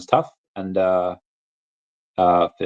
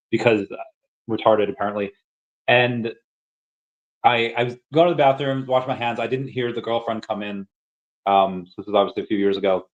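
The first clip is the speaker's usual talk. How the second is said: usual speaking voice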